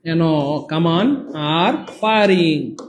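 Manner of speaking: Firing drawn out very slowly; slow dictation. Shown as a demonstration. Teacher slowly reading the exam sentence to students. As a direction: read "এনো কামান আর firing" word by word, slowly.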